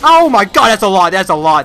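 shrieks high and loud, then calms down over the repeats; panicked yelp settling into shaken muttering; startled scream. Real, not acted. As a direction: jump out of your skin, then talk yourself down. Startled by sudden enemy attack.

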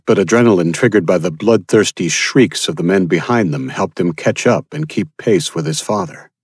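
normal talking voice

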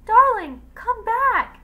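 very high, very loud calling out; shouting from afar. A narrator's reading. Husband shouts after his wife as her boat leaves. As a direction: call desperately across a distance. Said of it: "Sham shouted from across the deck".

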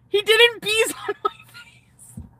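high whisper broken by giggles; giggly whisper. Real, not acted. Telling the camera about his kiss, giggly whisper. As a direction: giggle it out under your breath.

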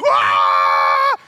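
long loud "wah" of a cheering crowd; roaring crowd yell. Retold or imitated. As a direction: yell out like the whole crowd erupting.

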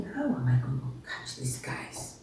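unvoiced, low whisper; whispered thought. Jesus thinking silently before answering, whispered.

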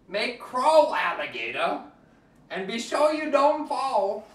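usual speaking voice

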